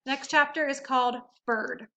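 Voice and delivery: ordinary delivery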